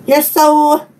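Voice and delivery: ordinary talk, nothing marked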